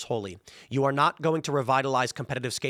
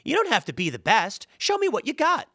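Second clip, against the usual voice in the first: much higher, sugary encouraging voice; mocking young woman; 9 semitones higher than usual. Young woman in the game encouraging the player, mocked in a high voice. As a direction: parody a gentle cheerleader. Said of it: "she's like".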